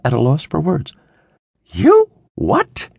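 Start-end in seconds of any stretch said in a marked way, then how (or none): 1.7-2.9 high, loud stunned outburst; shocked. A narrator's reading. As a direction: blurt it out in disbelief. Father hears shocking news.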